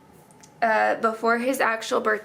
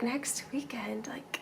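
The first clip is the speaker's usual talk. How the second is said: hushed, baffled question; whispered disbelief; 2 semitones higher, 6 dB quieter than usual. Retold or imitated. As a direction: whisper it in stunned confusion. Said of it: "And I was like".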